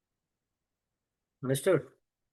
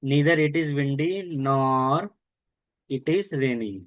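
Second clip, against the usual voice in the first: slow teaching read; much slower than usual. Shown as a demonstration. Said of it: "teacher reads formula aloud slowly".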